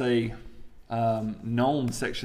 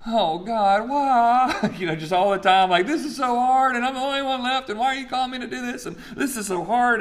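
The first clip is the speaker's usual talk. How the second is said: much higher, whiny hammed-up lament; mock weeping prophet; 10 semitones higher, 4 dB louder than usual. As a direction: play-act a wailing complainer. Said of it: "the Weeping Prophet".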